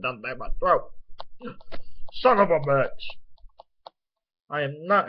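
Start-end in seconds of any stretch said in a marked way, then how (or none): 2.1-2.9 sharp, loud burst of frustration; angry curse. Real, not acted. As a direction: curse out loud as pain and annoyance hit. Blood drips down his throat.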